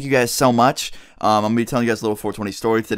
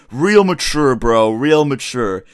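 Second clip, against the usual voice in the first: louder, sneering sarcasm at the friend; sarcastic; 4 dB louder, much slower than usual. Retold or imitated. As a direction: throw the words back with contempt. Slapped kid's sarcastic retort.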